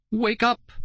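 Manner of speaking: high pleading cry; desperate high plea; begging. A narrator's reading. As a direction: beg him to wake up. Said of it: "I begged".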